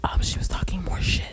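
breathy ASMR whisper, unvoiced. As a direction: whisper close to the mic like an ASMR video. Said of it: "A S M R review".

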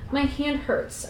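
high, pained little voice; pained attempt to speak. A narrator's reading. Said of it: "I tried to tell him".

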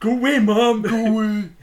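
yelling. Retold or imitated. Son yells back at mom.

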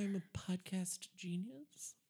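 soft, slow breathy brag; hushed smug whisper; mock whisper. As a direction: whisper it like a secret boast. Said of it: "bragging whisper".

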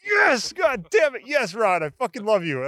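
victory shout; triumphant yell. Retold or imitated. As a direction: cheer the win. Celebrating getting it right.

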